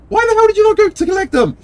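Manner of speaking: high, loud, fast mock yell; exasperated yell. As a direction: shriek it out at the careless heroes. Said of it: "And it's like".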